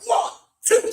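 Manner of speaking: high, loud punched-out count; mimed slaps in the voice; acted-out blows. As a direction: count out each blow sharply. Acts out blows being given.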